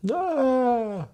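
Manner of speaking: high, drawn-out mock wail; a short imitated cry of grief, pitched high. Remembering his tears, a brief mock wail.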